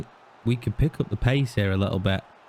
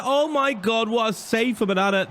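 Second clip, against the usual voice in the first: amazed reaction; 7 semitones higher than usual. Real, not acted. Reacting to a big save.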